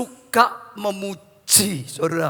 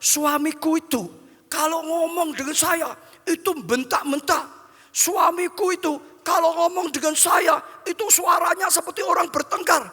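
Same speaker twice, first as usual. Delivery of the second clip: higher-pitched, lightly acted wifely complaint; complaining, pitched up but not shouted; aggrieved wife; 7 semitones higher than usual. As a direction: voice a wife's grievance in a raised pitch.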